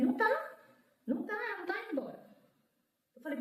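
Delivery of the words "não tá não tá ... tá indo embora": frantic denial. Retold or imitated. Sister denying patient is leaving, high rapid protest. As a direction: refuse it in a rush of retold panic.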